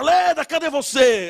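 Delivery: a hint of a drunken angry husband; drunk husband calling. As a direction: bellow for your wife as you stagger in.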